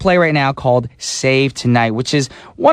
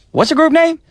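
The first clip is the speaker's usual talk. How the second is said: friend asking, pitched much higher; pushy friend; 13 semitones higher than usual. Retold or imitated. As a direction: press him for the answer, rising. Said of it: "My friends call me like".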